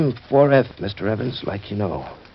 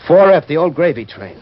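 louder, higher, bitter scoff; bitter outburst; 5 semitones higher, 6 dB louder than usual. Acted. Joe to his boss, bitter and louder. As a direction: spit the phrase out with contempt.

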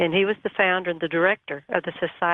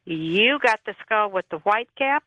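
mildly raised voice, slow and drawn out; retold louder repeat; 3 semitones higher, slower than usual. Skull collector repeats himself down the phone, mock louder. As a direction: imitate asking it louder, word by word. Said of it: "but then he got louder".

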